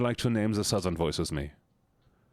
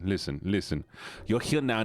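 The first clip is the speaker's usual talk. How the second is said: ordinary talk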